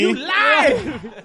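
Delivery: high, loud laughing accusation; laughing mock outrage.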